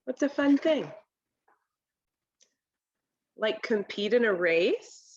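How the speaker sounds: ordinary delivery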